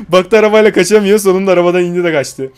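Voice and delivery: loud excited commentary with a laugh; excited gameplay shout. Real, not acted. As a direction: call it out loudly, delighted.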